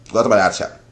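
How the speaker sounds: usual speaking voice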